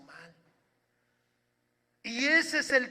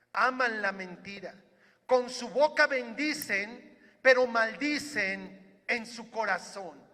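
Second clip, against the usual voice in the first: measured, solemn reading of a psalm aloud; scripture reading; 4 dB louder than usual. Acted. Pastor reading the psalm to his church, solemn. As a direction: read the verses to the congregation with gravity.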